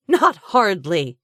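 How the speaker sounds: slow, loud scornful scoff. A narrator's reading. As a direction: scoff it with contempt. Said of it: "she scoffed".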